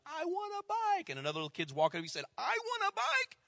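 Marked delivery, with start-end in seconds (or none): 0.1-1.1 much higher, a mild mock kid's plea; little girl begging. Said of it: "little girl screaming". 2.4-3.4 put-on little kid begging. Another kid asking for bike.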